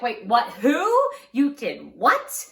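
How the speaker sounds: loud, high, stunned questions, last one slowed; shocked. Retold or imitated. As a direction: gasp it out in disbelief. Mom shocked by sister's news.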